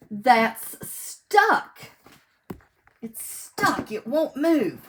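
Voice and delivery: loud, sing-song picture-book reading; reading to children. Acted. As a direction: read each page big and bright for little listeners. Reads picture book aloud.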